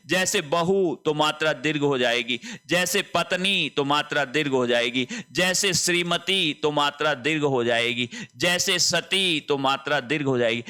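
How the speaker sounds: ordinary delivery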